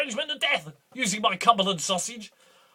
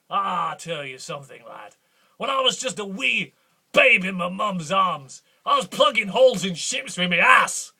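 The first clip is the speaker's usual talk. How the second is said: ordinary talk, nothing marked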